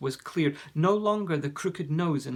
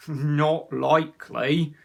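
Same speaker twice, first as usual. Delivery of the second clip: laughing troll; 8 dB louder, slower than usual. A narrator's reading. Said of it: "laughed the troll".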